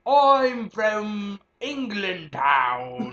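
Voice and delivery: put-on high, very slow posh British accent; hammed-up posh British voice; mock Queen's English. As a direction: drawl it like a prim English lady. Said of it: "There we go".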